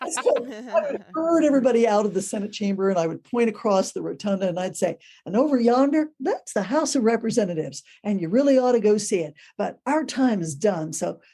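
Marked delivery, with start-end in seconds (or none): 5.2-11.2 a mock Texas twang, cheerful and folksy; folksy Texas tour guide. Shown as a demonstration. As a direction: play the down-home Texas guide the tourists expect. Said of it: "they expect you to talk that way".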